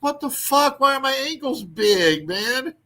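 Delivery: high exasperated outburst; mock dismay. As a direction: whine it in disbelief at your own ankles. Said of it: "I'm like".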